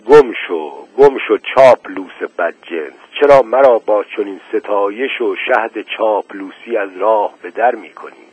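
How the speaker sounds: usual speaking voice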